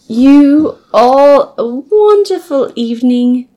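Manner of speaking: high, loud, slow British sign-off; hammed-up British farewell, every word stretched; emphatic mock British. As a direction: bid farewell as an over-grand British host. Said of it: "It's so emphatic".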